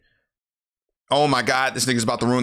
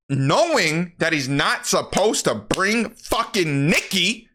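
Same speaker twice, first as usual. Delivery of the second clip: ordinary talk, nothing marked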